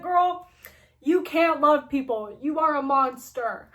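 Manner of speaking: acted-out villain ridicule; mocking. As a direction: make fun of them with exaggerated scorn. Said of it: "laughing at us and making fun of us".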